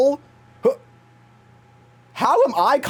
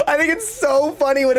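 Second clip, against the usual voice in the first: said through real laughter; laughing; 8 semitones higher than usual. Real, not acted. Telling viewers it's funny, still laughing. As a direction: talk while still laughing.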